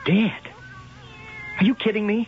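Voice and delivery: can't believe what he just heard; shocked yelp down the phone; shocked disbelief. Acted. Lieutenant hears of murder by phone.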